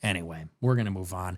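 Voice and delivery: usual speaking voice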